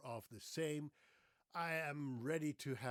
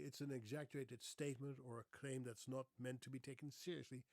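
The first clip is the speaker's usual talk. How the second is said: ordinary talk, nothing marked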